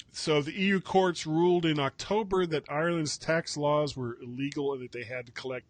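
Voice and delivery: ordinary talk, nothing marked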